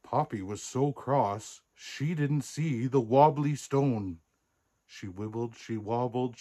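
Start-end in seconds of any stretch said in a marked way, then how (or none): none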